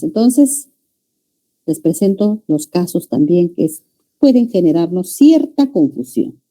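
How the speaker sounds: normal talking voice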